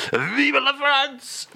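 high, long-drawn French rallying cry; excited. Acted. French guest gets carried away, excited cry. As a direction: cheer it patriotically, stretched out high. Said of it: "it's getting excited now".

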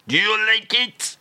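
a comic Frenchman fishing for praise; hammed-up French question; mock French accent.

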